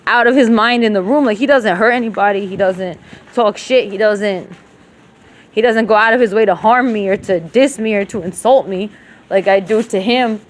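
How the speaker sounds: ordinary talk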